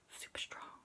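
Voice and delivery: soft awed whisper. A narrator's reading. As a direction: whisper it like a secret marvel. Bedtime story, Superman's strength.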